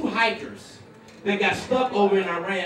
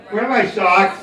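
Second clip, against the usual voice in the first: helpless husband; 5 dB louder, slower than usual. Acted. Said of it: "This is me. You're like".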